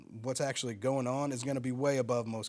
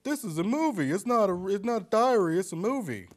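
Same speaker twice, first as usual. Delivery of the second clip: put-on higher, louder talking down like to a child; mock condescending tagline; 6 semitones higher, 4 dB louder than usual. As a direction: spell it out as if to an idiot. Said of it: "which they even have to tell you at the beginning".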